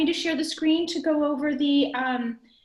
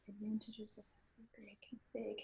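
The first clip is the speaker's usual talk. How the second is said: reading under her breath; whispering; 2 semitones lower, 21 dB quieter than usual. Real, not acted. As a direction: whisper the slide text to yourself. Reading slide to herself.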